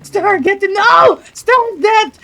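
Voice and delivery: high panicked shouting; panicked shriek. Real, not acted. Panic as fire burns stuff.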